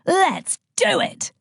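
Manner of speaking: very high, slow battle-cry voice; eager battle demon. Acted. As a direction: stretch it out like a war cry. Demon of battle cries speaks.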